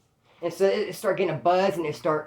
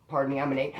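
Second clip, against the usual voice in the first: ordinary delivery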